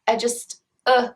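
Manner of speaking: ordinary talk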